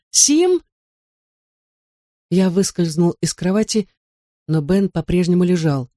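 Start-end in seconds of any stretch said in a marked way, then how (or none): 0.1-0.6 very high, questioning cry of a name; piercing call. A narrator's reading. Wife calls again for her husband, piercingly. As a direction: pitch it far up, calling through the house. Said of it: "пронзительный голос миссис Сирс".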